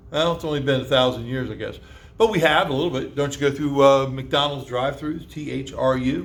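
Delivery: ordinary talk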